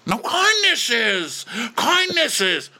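slow, very high hammed-up squeal; imitating an indignant man; mock exasperated correction. Shrieked correction in acted quarrel.

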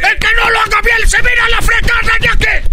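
shrill screamed threat; screaming. Acted. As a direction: scream it at the top of your lungs. Host repeats threat screaming.

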